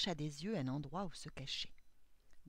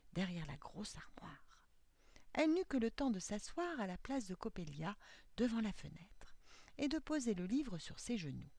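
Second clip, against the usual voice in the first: plain reading voice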